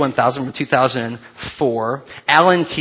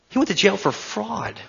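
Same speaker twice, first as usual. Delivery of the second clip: much higher, disbelieving echo; stunned repetition of a fact; incredulous repeat; 7 semitones higher, 4 dB quieter than usual. Shown as a demonstration. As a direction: say it again like you can't believe it.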